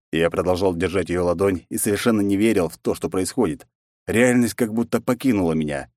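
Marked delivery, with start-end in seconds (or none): none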